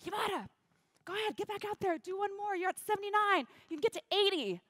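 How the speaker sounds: high, projected coach's order; imitated rousing pep call; urging organiser. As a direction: send a flagging runner back out. Organiser urges her back out.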